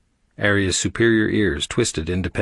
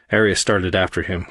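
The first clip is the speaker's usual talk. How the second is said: ordinary delivery, nothing marked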